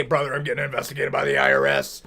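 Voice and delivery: low, hushed confession; confiding friend. Acted. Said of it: "if you say".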